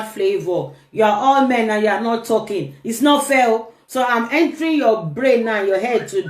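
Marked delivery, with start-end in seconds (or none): 2.8-3.6 pitched-up mock protest at silent viewers; half-joking it's-not-fair whine; playful complaint. Real, not acted. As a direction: tease them for not talking. Teasing silent male viewers.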